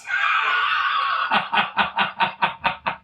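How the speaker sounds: high, breathless wheeze of laughter; long voiceless laughing fit; wheezing laugh. Real, not acted. Laughs after own rant.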